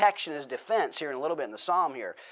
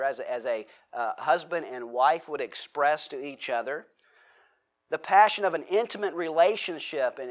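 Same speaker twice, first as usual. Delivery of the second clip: usual speaking voice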